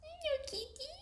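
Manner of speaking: very high, soft coo. Real, not acted. Cat sitter greeting a kitty, soft high baby talk.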